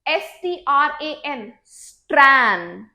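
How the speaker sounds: एस टी आर ए एन spelled letter by letter, then स्ट्रैन; spelled out slowly. Shown as a demonstration. Teacher spells out the word for the class, slowly. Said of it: "So it's".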